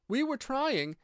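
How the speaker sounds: high, cut-off plea; humble explanation. A narrator's reading. Gardener kneeling before the Queen, humble high plea. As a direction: begin explaining meekly in a high voice. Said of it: "in a very humble tone".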